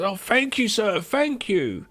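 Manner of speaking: high, fawning mock thanks; hammed-up grovelling gratitude; grateful beggar. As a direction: gush humbly, drawing out the thanks. Homeless man thanks the rich host.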